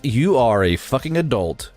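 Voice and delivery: usual speaking voice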